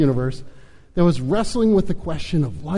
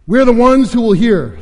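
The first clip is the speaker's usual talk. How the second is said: louder, higher, thrilled announcement; excited proclamation; 7 semitones higher, 8 dB louder than usual. Acted. Fuge proclaims their great role.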